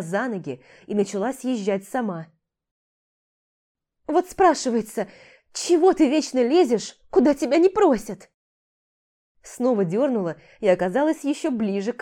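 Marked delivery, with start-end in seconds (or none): 4.1-8.3 high, loud grumbling scold. Acted. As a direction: tell her off, fed up. Dariana scolds Jim.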